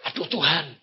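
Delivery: ordinary delivery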